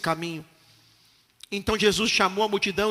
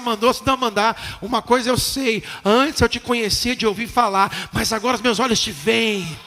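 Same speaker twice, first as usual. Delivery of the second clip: ordinary talk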